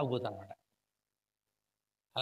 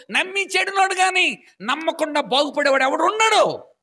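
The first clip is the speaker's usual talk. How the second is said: louder, higher, slow fervent preaching; fiery preaching; 7 semitones higher, 9 dB louder, slower than usual. Acted. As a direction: proclaim it with full force. Preaching at full force. (ఉద్రేకంతో గట్టిగా బోధించడం)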